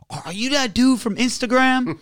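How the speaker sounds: put-on high, excited young guy voice; imitating drive-thru guy. Drive-thru worker recognizing him, high starstruck voice. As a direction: squeak it out like a starstruck fan. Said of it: "he was like".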